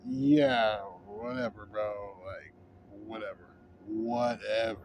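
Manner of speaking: low, very soft, unbothered brush-off; dismissive. Acted. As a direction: brush them off quietly, barely bothering. Looking at them, dismissive retort.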